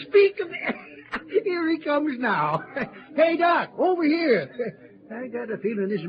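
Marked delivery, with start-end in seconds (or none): 0.1-2.9 laughing. Acted. Les laughing as Doc arrives. 3.1-4.8 loud, high hail over the crowd; cheerful shout to a friend across the saloon; calling out across a room. Acted. Calling Doc across crowded cafe.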